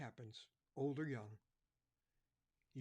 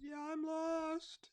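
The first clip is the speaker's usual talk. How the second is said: put-on very high, slow small child's voice; tearful little boy answering the cop; crying little boy; 6 dB louder, much slower than usual. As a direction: play a lost five-year-old. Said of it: "He's crying".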